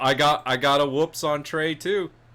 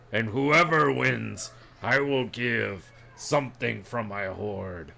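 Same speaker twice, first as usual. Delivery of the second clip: slow, scornful ancient dragon; bored dragon voice; 3 semitones lower, slower than usual. Acted. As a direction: speak down to them as a huge dragon.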